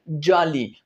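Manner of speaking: ordinary talk, nothing marked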